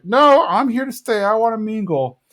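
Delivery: high-pitched refusal; playful imitation of a stubborn guest; insistent Bill as Lenore. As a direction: pitch it up, digging your heels in. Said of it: "but he's like".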